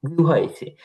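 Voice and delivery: usual speaking voice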